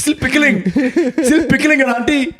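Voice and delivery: high, slow laughing punchline; laughing joke about auntie; mock laughing. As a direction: sing it out through laughter. Joke voice about pickled aunt.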